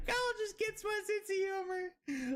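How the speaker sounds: high squealing laugh-talk; laughing hard. Real, not acted.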